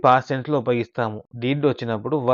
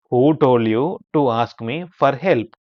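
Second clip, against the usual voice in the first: slow model reading; 4 dB louder, much slower than usual. Shown as a demonstration. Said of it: "teacher models English sentence slowly".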